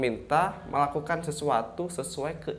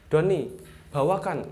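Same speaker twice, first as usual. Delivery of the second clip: "Doni, bawakan" pitched up and louder; high command intonation; 3 semitones higher, 5 dB louder than usual. Shown as a demonstration. Teacher shows pupils how to order Doni, high command intonation. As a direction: give "Doni, bawakan" as a clear, raised command. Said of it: "Harus naik".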